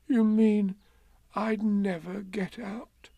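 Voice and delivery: high voice, slowing and fading; dismayed. A narrator's reading. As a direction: ask in dawning alarm. Pooh dismayed at being stuck forever.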